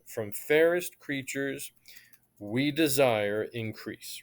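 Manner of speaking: slow, each beat of "From fairest creatures, we desire increase" marked; scanned reading. Shown as a demonstration. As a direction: read it out in iambs. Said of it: "marking the unstressed, stressed".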